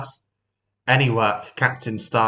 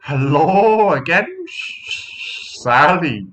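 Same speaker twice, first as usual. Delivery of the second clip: slow, dreamy greeting; smitten hello; 6 semitones higher, 4 dB louder, much slower than usual. Acted. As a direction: lovestruck and gentle. Sunshine, always pleased.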